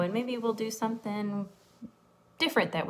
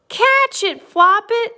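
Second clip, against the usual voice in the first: high, loud playful cry; gleeful acted shout, no real laugh; merry storybook call; 13 semitones higher, 16 dB louder, slower than usual. A narrator's reading. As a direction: call it out as amused friends. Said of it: "laughed his friends".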